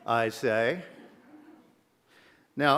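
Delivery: usual speaking voice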